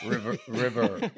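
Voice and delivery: laboured R at the end of "river"; pronouncing the R's. Shown as a demonstration. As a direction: practise "river" with the final R sounded, amused. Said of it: "pronounce river with the R's".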